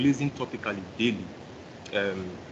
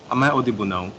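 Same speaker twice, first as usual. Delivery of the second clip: louder; 7 dB louder, faster than usual. Real, not acted. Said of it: "Yes, it's better".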